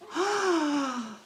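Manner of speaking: high, soft drawn-in gasp; delighted gasp. Real, not acted. Streamer seeing herself in the monitor, delighted gasp. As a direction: gasp high at a cute sight.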